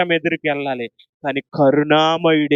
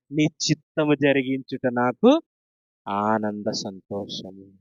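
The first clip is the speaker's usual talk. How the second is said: hushed prayer; 7 semitones lower, slower than usual. Acted. Jesus praying in Gethsemane.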